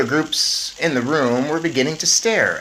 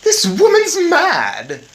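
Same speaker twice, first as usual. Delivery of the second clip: high, loud, slow and sneering; haughty Mrs Jenkins; 8 dB louder, much slower than usual. Acted. Snobbish mother dismisses the old woman, high and loud. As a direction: look down your nose at her. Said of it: "Miss Jenkins said".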